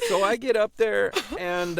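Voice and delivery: normal talking voice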